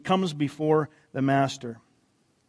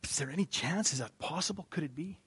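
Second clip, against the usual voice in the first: hopeful whisper; 13 dB quieter than usual. Retold or imitated. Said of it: "the next guy in line is going".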